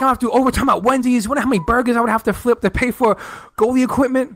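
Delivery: high, hurried mock complaint; aggrieved friend. Friend frets about paying for gear.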